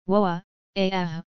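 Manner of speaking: startled exclamations, fading out; a sudden shocked woa; shocked gasps. Acted. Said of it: "shocked voices".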